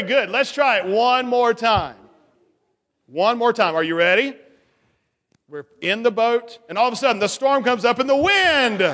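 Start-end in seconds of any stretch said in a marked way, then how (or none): 5.5-8.8 dramatic storm build. Acted. Teacher staging the storm for children, building up. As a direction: swell it up like a rising storm.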